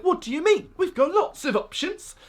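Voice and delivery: high-pitched, badly faked British accent; hammed-up British accent of an indignant objector; botched British accent. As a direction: put on a dodgy British voice. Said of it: "I don't know what that accent was".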